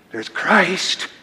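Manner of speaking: soft, hushed stage whisper; mock whisper. Shown as a demonstration. Preacher mimicking how Rome must whisper Christ, hushed. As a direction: say it as if it must not be heard. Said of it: "you have to whisper that".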